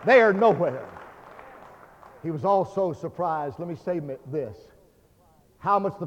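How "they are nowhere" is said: loud, emphatic preacher delivery; forceful preaching. Real, not acted. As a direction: drive each phrase home with punched key words.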